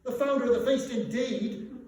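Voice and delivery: wife mocks the toast to Scrooge, loud and sarcastic; indignant cry. A narrator's reading. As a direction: throw his words back at him, scornful. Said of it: "cried Missus Cratchit".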